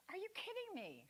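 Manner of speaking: high, incredulous mock outrage; exasperated disbelief. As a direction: play up the disbelief. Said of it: "That's my favorite saying".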